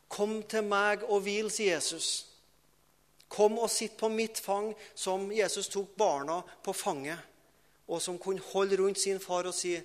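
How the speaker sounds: high-pitched, insistent pulpit appeal; fervent preaching. Real, not acted. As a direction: preach the invitation with urgency.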